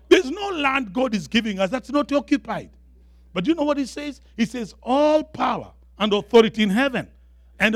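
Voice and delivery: high-pitched, emphatic sermon delivery; full-force preaching. Real, not acted. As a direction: drive the point home to the congregation.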